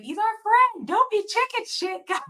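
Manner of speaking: put-on high-pitched little girl pleading. Little girl tells friends not to be scared, high mock plea. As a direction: imitate a small girl, voice pushed way up.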